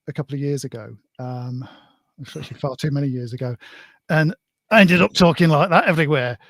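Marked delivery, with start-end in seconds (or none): 4.7-6.4 loud, put-on Blackpool accent; imitated Blackpool accent. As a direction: slip into a broad Blackpool accent. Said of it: "one of mine is accents".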